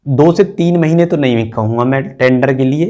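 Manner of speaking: ordinary talk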